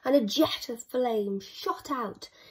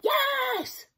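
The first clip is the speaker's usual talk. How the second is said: much higher excited yes; eager cry. Acted. Vikings answering the dragon, eager cry. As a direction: cry out keenly. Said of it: "cried the Vikings".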